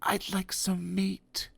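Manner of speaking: shy, barely heard; timid quiet request. Acted. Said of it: "he whispered".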